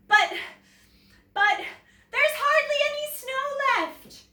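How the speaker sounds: nervous. A narrator's reading. Nervous child facing huge dinosaur.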